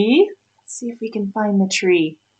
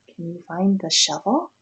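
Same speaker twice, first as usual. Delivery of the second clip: plain reading voice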